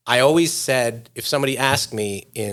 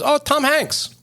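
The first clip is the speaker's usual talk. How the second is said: put-on much higher "Oh, Tom Hanks" in a Thai cabbie accent; Thai cab driver accent; slower than usual. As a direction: chirp the name like the Thai driver. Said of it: "And he goes".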